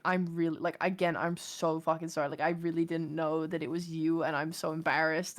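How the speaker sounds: sheepish apology. Retold or imitated. Apologising again to the dog walker, low and sheepish. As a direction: retell a contrite apology in a dropped voice.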